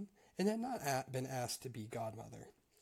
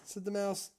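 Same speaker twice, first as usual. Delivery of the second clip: higher, louder read-aloud storytelling voice; story narration; 4 semitones higher, 4 dB louder than usual. A narrator's reading. Reader telling a Grimm tale to listeners, story narration. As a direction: read it out clearly as a fairy tale.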